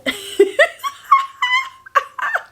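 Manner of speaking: sudden high-pitched burst of laughter. Real, not acted. Laugh heard, no words.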